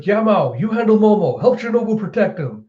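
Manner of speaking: high-pitched urgent call; retold hurried command; calling out orders. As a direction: shout instructions to an ally in acted urgency. Luffy calls orders mid-battle.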